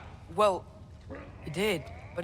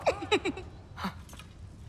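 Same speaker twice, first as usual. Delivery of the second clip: unvoiced giggling laugh, soft; breathy laugh; 6 dB quieter than usual. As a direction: laugh under your breath at his fright. Sneaking laugh after startling.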